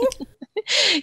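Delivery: bright high laugh; light laugh. Real, not acted. Host laughs lightly with her guest about the twang, high and bright. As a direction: laugh warmly at the guest's answer.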